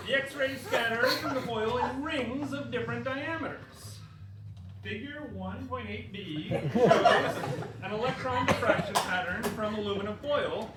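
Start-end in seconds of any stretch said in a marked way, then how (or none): 0.1-7.3 giggling through the words; louder giggly reading; laughing while reading. Real, not acted.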